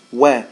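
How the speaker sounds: Wa said light and crisp; light waw. Shown as a demonstration. Teacher showing the light waw. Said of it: "Waw is a light letter".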